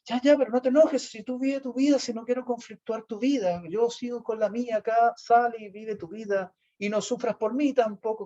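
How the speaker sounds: hammed-up wounded sweetness; theatrical martyr. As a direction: overplay the selfless victim. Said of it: "la actuación era más así".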